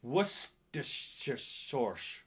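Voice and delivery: slow, slurred Worcestershire, "wuss-tuh-shuh shorsh"; laboured, low, slushy Worcestershire sauce; struggling to say it. Shown as a demonstration. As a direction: stumble slowly through Worcestershire, sauce turning to "shorsh". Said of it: "It's very difficult to say".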